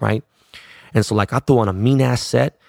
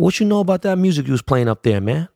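[name]; 6 semitones higher than usual. Retold or imitated. [name] quizzing him on music.

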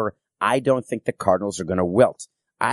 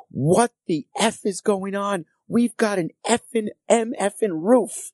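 slow, high, angry rant in another voice; furious owner; 11 semitones higher, slower than usual. Retold or imitated. Team owner cursing at his president about the open roof, angry slow yell. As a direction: spit out each word like an enraged boss.

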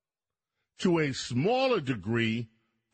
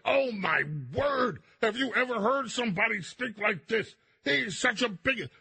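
higher, put-on gasping voice of outraged pundits; mock shocked elites; 7 semitones higher than usual. Host mocks media's horror at the escalator speech, in a higher prissy put-on voice. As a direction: mimic the clutching-pearls media crowd. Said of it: "And everybody was like".

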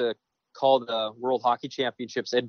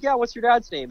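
eager question; 10 semitones higher, 3 dB louder than usual. Retold or imitated. Signing for waitress's dad, acted.

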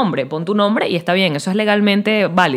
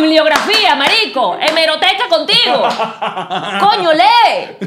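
higher, laughing, with a drawn-out slow lee; exasperated retort; 6 semitones higher, slower than usual. Retold or imitated. S0 answering lazy commenters, mock exasperated. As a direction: scold them for not reading, half laughing. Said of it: "Yo digo".

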